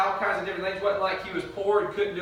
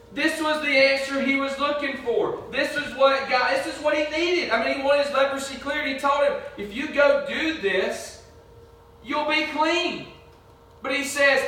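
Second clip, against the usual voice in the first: raised, emphatic sermon delivery; impassioned preaching; 8 semitones higher, 3 dB louder than usual. Real, not acted. Preacher telling the congregation of Nahum's rage, impassioned preaching. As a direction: preach it higher and louder, driving each point home.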